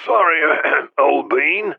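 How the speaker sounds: high, croaky, throat-clearing sick voice; croaking voice. A narrator's reading. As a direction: croak it out like a sick old man. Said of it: "a croaking voice came on the line".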